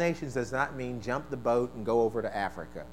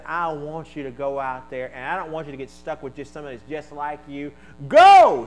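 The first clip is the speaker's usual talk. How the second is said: commanding shout; 12 dB louder than usual. Acted. Preacher voices Jesus commanding.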